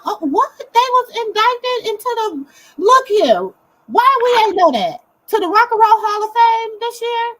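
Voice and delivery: high, loud, stunned disbelief; can't believe the news; amazed. Real, not acted. Amazed at Hall of Fame news.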